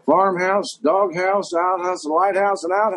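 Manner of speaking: put-on Southern accent, quoting The Fugitive; Tommy Lee Jones. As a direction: snap the list out as a Southern lawman. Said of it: "Tommy Lee Jones today".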